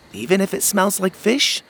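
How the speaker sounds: put-on high little boy asking, rising; a small kid teasing his grandpa; young boy voice, curious; child voice. A narrator's reading.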